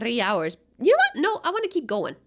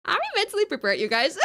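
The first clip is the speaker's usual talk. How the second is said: laughing re-ask; 8 semitones higher than usual. Shown as a demonstration. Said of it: "Are we mentally prepared for this?".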